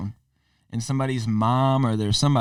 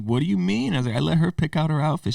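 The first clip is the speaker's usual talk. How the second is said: higher-pitched puzzled self-defence; retold defensive protest; 6 semitones higher than usual. Dad answering her mom's complaint, retold defensive protest. Voices own protest when scolded.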